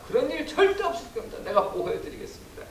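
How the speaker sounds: stepping up boldly. Acted. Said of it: "베드로가 탁 나서서 그러는 겁니다".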